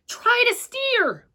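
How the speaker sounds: high, slow call across the field; called out high and drawn out. Acted. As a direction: shout instructions from afar. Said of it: "I called to her".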